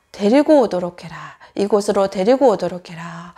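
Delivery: low, loud voice of authority; commanding Jesus. Acted. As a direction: give a solemn order in a deep voice. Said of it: "그런 명령을 내리실 수 있는".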